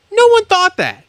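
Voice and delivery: very high, indignant outburst; exasperated rebuttal. Real, not acted. Host dismissing Mathis's accusation about the crowd, exasperated. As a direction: voice leaping up in angry disbelief.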